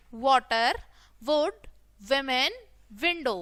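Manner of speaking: ordinary talk, nothing marked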